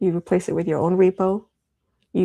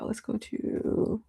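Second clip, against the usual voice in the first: muttered aside; 2 semitones higher, 9 dB quieter, much slower than usual. Real, not acted. Muttering while fixing a slip.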